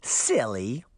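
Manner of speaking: put-on childish, teasing girl voice; a creepy little girl teasing; girlish taunt. A narrator's reading. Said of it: "The little girl laughed".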